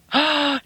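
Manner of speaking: quick gasp of dismay; a startled little gasp; shocked gasp. Real, not acted. Pencil tip breaks.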